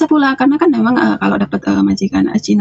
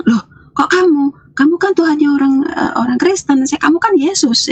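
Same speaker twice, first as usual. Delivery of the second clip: startled disbelief; 6 semitones higher than usual. Retold or imitated. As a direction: recoil in amazement at a familiar face. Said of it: "saya kaget di situ".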